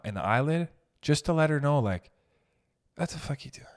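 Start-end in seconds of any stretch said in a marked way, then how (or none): 3.0-3.7 whisper. Retold or imitated. Silent stare at grocery cashier.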